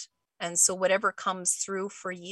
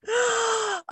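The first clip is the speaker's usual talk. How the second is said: high theatrical gasp; a sharp, high stagey intake of breath; mock gasp. Host mocking suspense, high playful gasp.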